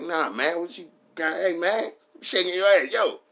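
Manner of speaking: high mock midget voice. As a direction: mimic a small guy heckling from below. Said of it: "He's like".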